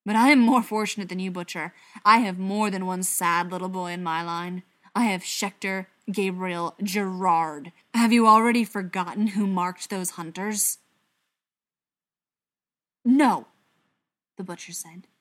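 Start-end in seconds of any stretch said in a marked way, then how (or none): 0.1-10.8 loud, angry rebuke; heated retort, hitting words hard; snarling. A narrator's reading. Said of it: "Briar snarled". 13.0-13.5 loud, higher, incredulous no; raised-voice disbelieving denial; disbelief. A narrator's reading. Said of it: "shaking his head in disbelief".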